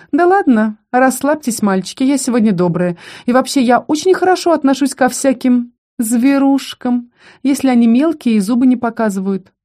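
high, condescending mock kindness; mocking female bully. Voices Лейка mocking the boys.

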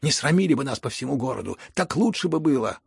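loud, exasperated reproach; irritated shout. A narrator's reading. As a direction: scold him loudly, rising at the end. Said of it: "крикнул Ганя".

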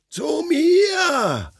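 very high, piercing cry for help; heartrending scream. A narrator's reading. Friend upstairs screaming for help in terror. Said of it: "herzzerreißendes Geschrei".

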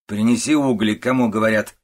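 loud, scolding order; angry shout. A narrator's reading. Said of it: "крикнула женщина". (сердитый окрик)

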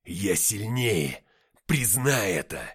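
loud, slow, drawn-out insistence; forceful drawn-out shout; insistent demand. A narrator's reading. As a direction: press the opponent to admit it, loudly. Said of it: "и повторяет".

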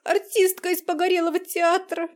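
loud sobbing wail over the phone; crying on the phone. Acted. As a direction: wail it out between sobs. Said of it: "прорыдала в трубку Азалия".

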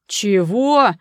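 loud, drawn-out indignant roar. A narrator's reading. Ivan hearing Gera proposed to Angela, roaring. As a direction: bellow it in disbelief. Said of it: "взревел Иван".

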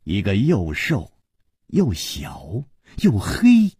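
usual speaking voice

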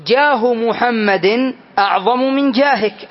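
slow dictation. Shown as a demonstration. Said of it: "teacher spells out full form".

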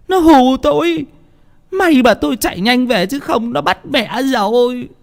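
put-on high-pitched, whimpering old wife; scared and plaintive, pitched very high; frightened old woman. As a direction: recount the fright in a high, shaken voice.

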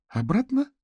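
a high, startled gasp of a question; frightened. A narrator's reading. Katerina hears her man was in prison, frightened and high. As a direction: jump up in alarm at the word. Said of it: "испуганно проговорила Катерина".

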